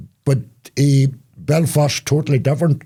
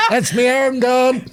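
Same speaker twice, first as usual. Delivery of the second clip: mock dismay; much slower than usual. Playing a leper losing bits.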